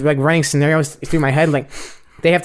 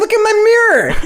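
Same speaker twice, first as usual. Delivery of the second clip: much higher, louder exasperated yell; retold outraged yell; 4 dB louder than usual. Driver yelling at the lady to look at his smashed mirror. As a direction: make her look, exasperated.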